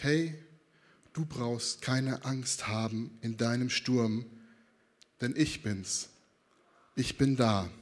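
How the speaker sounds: low, soft, slow and tender; calm someone's fear with slow, quiet words; gentle, unhurried comfort; reassuring. Acted. Jesus reassuring someone in a storm.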